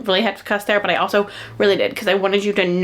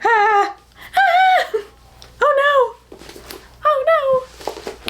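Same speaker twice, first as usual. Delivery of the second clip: mock embarrassed squeal; much slower than usual. Recalling the book's ending to viewers, mortified squealing. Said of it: "I was like".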